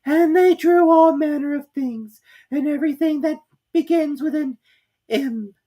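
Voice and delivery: yawning little dormouse; sleepy Dormouse. Acted. Dormouse continues.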